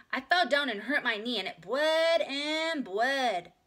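put-on high, loud child show-off; proud little girl. A narrator's reading. Ramona shows off her scab, high, loud and proud. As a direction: boast it like a four-year-old. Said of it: "she said proudly".

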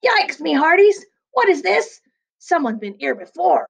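startled pirate. A narrator's reading. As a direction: jump at the sight, voice shooting up.

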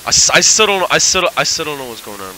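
confused shout, tripping over the words; exasperated confusion. Real, not acted. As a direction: blurt it out at full volume, baffled. Confused by game, repeats louder.

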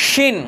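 শিন with the tongue against the palate, full sh sound; thick shin. Shown as a demonstration. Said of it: "একটু মোটা করে জিভটাকে একটু টাকরার সঙ্গে চেপে দিয়ে বলেন".

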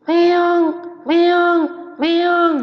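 high, loud meowing cat; playful kitten meow. Shown as a demonstration. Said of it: "Kucing suarane".

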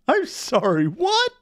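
high, astonished double-take; baffled disbelief. Real, not acted. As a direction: shoot up high on the question. Baffled reaction to the game.

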